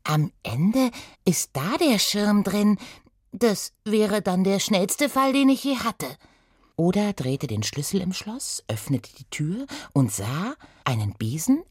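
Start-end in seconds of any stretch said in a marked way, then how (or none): 0.0-6.1 hohe, langsame Mädchenstimme, eifrig; Oda, eifrig. Acted. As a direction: denk als stolze kleine Detektivin laut. Oda denkt laut. (Oda überlegt vor dem Schrank, hoch und eifrig)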